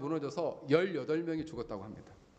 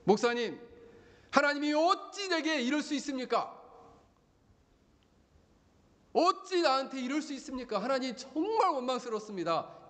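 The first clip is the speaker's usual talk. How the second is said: bitter complaint; 8 semitones higher than usual. Retold or imitated. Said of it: "불평을 터뜨렸습니다".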